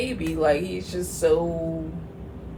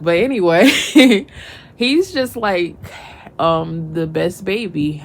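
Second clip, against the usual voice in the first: emotional; 7 dB louder than usual. Real, not acted. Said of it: "getting emotional when I drink".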